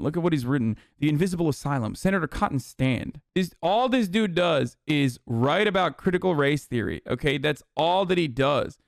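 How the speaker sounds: usual speaking voice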